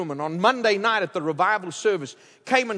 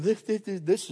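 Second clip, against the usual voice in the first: imitated tongue-tied stutter; mock-nervous stammering; 3 dB quieter than usual. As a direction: stumble over the first word, scared. Nervous pastor introducing him to Charlie.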